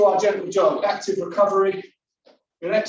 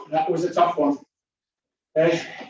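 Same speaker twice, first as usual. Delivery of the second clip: out of breath; 2 semitones lower than usual. Real, not acted. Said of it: "should feel a little bit out of breath".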